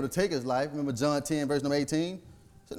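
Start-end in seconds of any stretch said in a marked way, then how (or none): none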